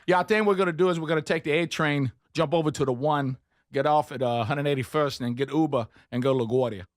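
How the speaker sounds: hammed-up New Yorker talk; mock New York accent. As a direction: play a know-it-all New York local giving directions. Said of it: "I started talking like New Yorkers".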